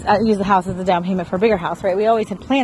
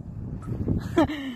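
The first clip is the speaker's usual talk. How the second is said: soft laugh; 7 semitones higher, 9 dB quieter than usual. Real, not acted. Laughing at herself.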